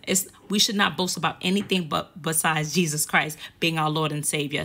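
usual speaking voice

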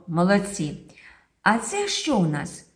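ordinary delivery

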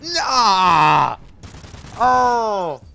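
high, loud outraged yelling; annoyed outburst. Real, not acted. Gamer whose guard dog was killed, annoyed yelling. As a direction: shout in protest, then wail it out. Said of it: "I'm annoyed".

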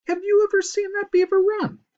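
high, loud scoff; scoffing disbelief. A narrator's reading. Character scoffs at friend's suggestion.

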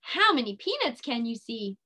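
ordinary talk

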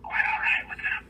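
soft unvoiced whisper back and forth. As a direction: whisper it like a secret. Whispered opening chat.

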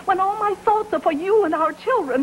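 high tearful voice; crying. Acted. Wife reproaches her husband about money, crying. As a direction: break into tears as you plead. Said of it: "don't cry".

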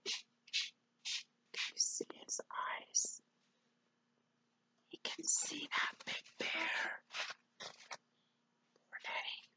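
soft, hushed slow whisper. Real, not acted. Reader pointing out scared Eddie to a child, whisper. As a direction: whisper it so the bear won't hear.

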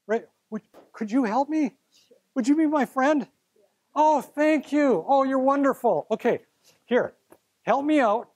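sweet mock plea for a volunteer; pleading helpless student. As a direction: play a lost kid asking for a friend. Teacher plays helpless student begging.

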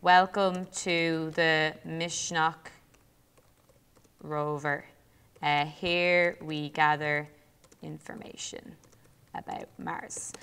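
slow, halting, fading almost to a whisper; dictating while typing. Real, not acted. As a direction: murmur the words haltingly as you type.

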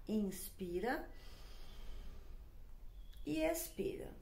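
hushed meditation guide; guided breathing. Shown as a demonstration. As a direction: lead the breath gently, softly, stretching each word. Guiding a breathing exercise.

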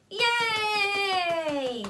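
high, loud yay; cheer. Acted. Crowd cheering the engagement. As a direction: celebrate with everyone.

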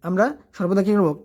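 ordinary talk

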